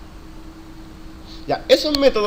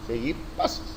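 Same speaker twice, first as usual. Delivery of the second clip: whispered echo; 8 semitones lower, 11 dB quieter than usual. Shown as a demonstration. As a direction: repeat it under your breath in a whisper. Soft echo of the phrase.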